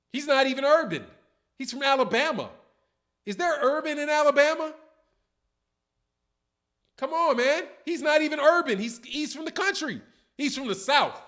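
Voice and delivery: high and loud, can't believe it; incredulous loud rant. Real, not acted. Host ranting at a chat comment, loud disbelief. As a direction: shout down the silly comment in disbelief.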